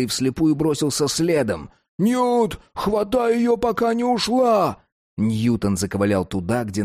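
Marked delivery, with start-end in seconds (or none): 2.0-4.8 put-on high urgent boy voice; boy shouting. Max shouting at Newton.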